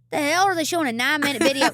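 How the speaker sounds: fast, high, loud mock outrage; retold disbelief. Singer retelling her reaction to a long video, incredulous. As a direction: rattle it off, incredulous.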